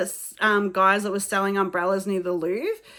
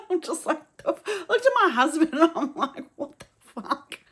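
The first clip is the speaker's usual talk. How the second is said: higher, laughing through the words; laughing disbelief; 7 semitones higher than usual. Real, not acted. Telling her husband the guard laughed, laughing in disbelief. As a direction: laugh in shock at the rudeness.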